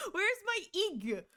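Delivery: high, slow mock whine. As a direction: pout it out slowly. Mock complaint about missing emote.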